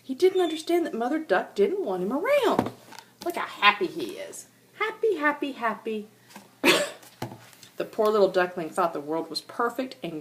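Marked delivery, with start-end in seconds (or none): none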